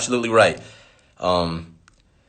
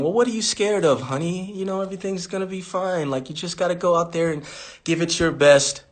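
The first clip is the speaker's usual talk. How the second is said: put-on softer, coaxing tone as if to a child; polite, gentle; 7 dB quieter than usual. Shown as a demonstration. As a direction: soothe them sweetly, calling them honey. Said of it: "There's the polite way going".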